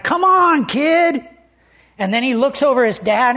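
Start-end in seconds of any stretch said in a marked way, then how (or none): 0.0-1.3 put-on high-pitched call from a teenager; mock yell to hurry a kid along; impatient teenager. Teenager waiting behind a small boy on the slide, impatient. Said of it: "teenager behind him".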